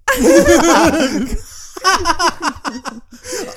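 real high-pitched cackle; high cackle at herself; loud laugh. Real, not acted. Laughing at own coffee habit.

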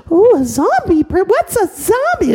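very high, loud questioning; curious little girl. Acted. Little girl asking what a zombie is, very high. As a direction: wonder aloud like a small child.

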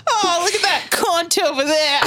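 a giggling Aussie jab at someone across the room; mock Australian accent. A mock Australian accent, joking about a sex worker, laughing.

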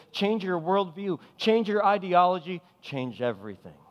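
repeated "Change" building in pitch, then dropping; pastor's rising call to change; preaching refrain. Acted.

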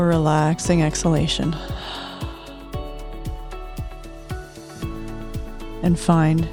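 unhurried soothing relaxation guide. Acted. Host leads closing guided meditation.

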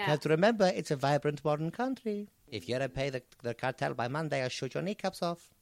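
wandering mock Mexican accent; Mexican slipping South African. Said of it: "now you sound a bit South African".

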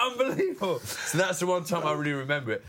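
ordinary talk, nothing marked

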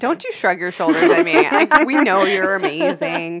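high, loud, insistent praise; emphatic mock scolding; playful scolding. Real, not acted. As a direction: tell off a modest friend loudly. Playfully scolding a modest guest.

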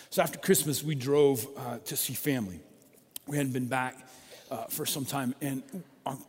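ordinary talk, nothing marked

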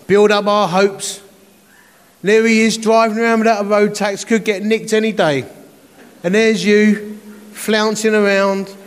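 mock-indignant neighbour scolding; annoyed June. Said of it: "June phones me up".

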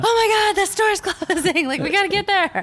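very high, loud frantic squeal; laughing, high-pitched comic yelling; mock panic. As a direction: shriek in hammed-up college party panic. Acting out panicked college drinkers.

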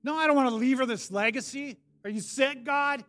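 high, loud, desperate shouting at God; anguished rage. Retold or imitated. Prisoner pleading with God, angry anguished shouting. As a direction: cry out in pain and anger. And I was pissed.

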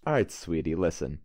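low, patronizing, lightly Brooklyn; Brooklyn tough-guy condescension. Acted. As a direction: talk down to her like a wiseguy. Player condescends to the NPC in character.

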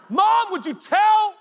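high, drawn-out whiny yell; whining tattle. Acted. Kid complaining to mom about a sibling, whiny yell. Acted family squabble through mom.

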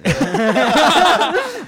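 loud high laughter; real belly laugh. Real, not acted. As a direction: crack up hard. Laughs at competitive dance joke.

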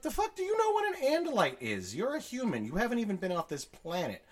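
high-pitched indignant scoffing; mock incredulous. Alien scoffs at human's claim.

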